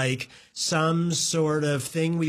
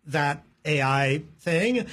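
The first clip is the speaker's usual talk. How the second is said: ordinary delivery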